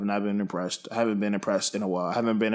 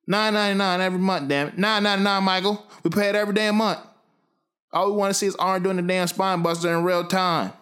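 loud, high-pitched indignant complaint; outraged rant; 10 semitones higher, 4 dB louder, faster than usual. Real, not acted. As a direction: yell it as a fed-up paying fan, pitched way up. Peak of rant about subscription price.

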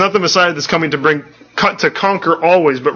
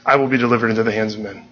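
darkness and doom; 5 semitones lower, 3 dB quieter, faster than usual. Retold or imitated. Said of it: "brings almost this sense of, of darkness and doom".

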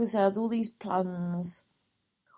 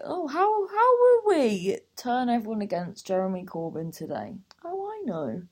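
high, scheming sneer; sarcastic mock voice; 4 semitones higher, 3 dB louder than usual. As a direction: parody a scheming tabloid editor. Mocking the tabloid's scheming voice.

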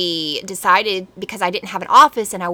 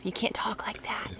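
whispered mockery of a weak voice; soft whisper; 13 dB quieter than usual. Shown as a demonstration. Husband whispering to show how weak she sounds. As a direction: breathe it out, barely voiced. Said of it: "That was not loud enough".

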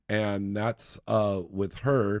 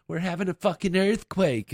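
higher, mock-alarmed retelling; retold alarmed exclamation; 8 semitones higher than usual. Woman tells her partner it's an earthquake, mock alarm. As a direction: exclaim it as the startled woman, pitched up.

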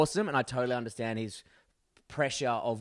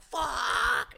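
very high drawn-out mock scream; retold scream. Passenger re-enacts the hairpin moment, high mock scream. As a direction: let out a thrilled yelp, held back in volume. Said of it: "I was screaming, man".

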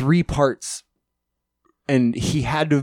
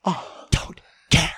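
whisper yelling; 8 semitones higher, slower than usual. Retold or imitated. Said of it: "whisper yell bit continues".

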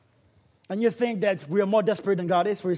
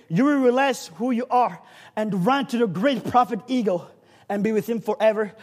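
ordinary talk, nothing marked

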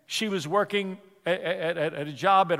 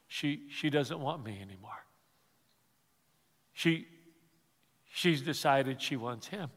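hushed heartbroken; 6 semitones lower, 8 dB quieter than usual. Retold or imitated. Heartbroken husband's words, retold.

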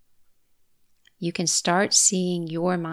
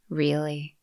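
monotone; 2 semitones lower than usual. Shown as a demonstration. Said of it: "say it in a monotone way".

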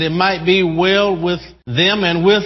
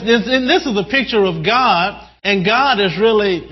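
ordinary talk

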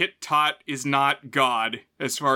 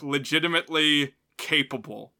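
ordinary delivery

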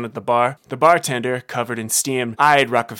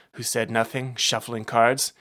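plain reading voice

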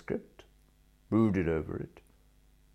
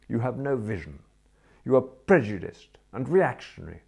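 haughty lecture; 5 semitones higher, 6 dB louder than usual. A narrator's reading. Bertie lectures Jeeves, raised voice.

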